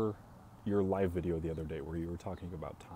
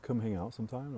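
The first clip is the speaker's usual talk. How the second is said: higher, eager friend asking; friend's eager ask; 6 semitones higher than usual. Retold or imitated. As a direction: ask like a keen buddy. Friend asking to come over.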